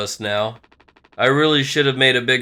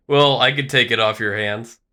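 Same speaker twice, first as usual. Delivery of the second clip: ordinary talk, nothing marked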